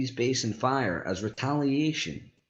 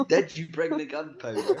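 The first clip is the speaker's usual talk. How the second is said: laughing through line; 8 semitones higher than usual. Acted. Villain line broken by laughter.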